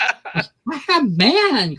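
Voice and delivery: high, very slow, drawn-out "I have man"; mock prim wife voice. To her co-host, a drawn-out mock-prim refusal. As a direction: play a scandalized married lady, stretching every word.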